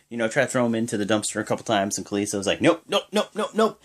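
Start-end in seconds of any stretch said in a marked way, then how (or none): 2.6-3.8 high, repeated mock nopes; playful refusal. Kalisto refusing to be thrown in the dumpster, high mock nopes. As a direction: bounce each nope off like a stubborn kid.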